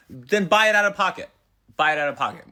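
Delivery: high, insistent acted nagging; exasperated demand. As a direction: demand it like a fed-up spouse. Acted argument pleading for concert ticket.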